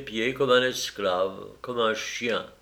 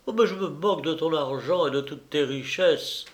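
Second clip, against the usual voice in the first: higher-pitched indignant scorn; angry outburst; 5 semitones higher than usual. A narrator's reading. Mistress scorning his money and ordering him out, carried away. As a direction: dismiss him with rising vehemence. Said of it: "fit-elle avec emportement".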